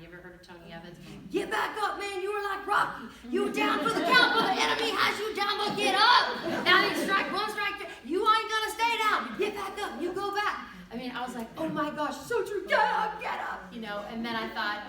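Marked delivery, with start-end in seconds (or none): 1.3-10.7 hammed-up preacher at full shout, pitched very high; Tony Evans. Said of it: "I could even hear Tony Evans in my mind". 11.6-13.7 high, loud excited outburst; worked-up mock shout. Echoes the preacher's shouting, worked up.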